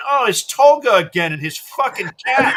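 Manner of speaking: loud, laughing groan at the ad; laughing exasperation. Retold or imitated. Flipping channels and finding the cat ad again, laughing loudly. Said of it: "I said".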